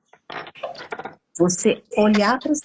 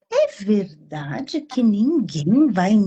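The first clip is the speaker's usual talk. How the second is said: ordinary delivery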